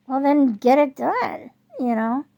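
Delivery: fed-up retort; frustrated. Real, not acted. Venting at contractors.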